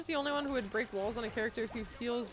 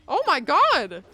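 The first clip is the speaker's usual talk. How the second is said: much louder gasp of delight; loud surprised exclamation; 3 semitones higher, 13 dB louder, slower than usual. Real, not acted. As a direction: shout it out amazed.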